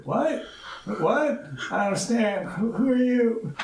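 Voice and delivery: high, puzzled questions; baffled, searching for words; bewildered stranger. Acted. Bewildered voice down the street.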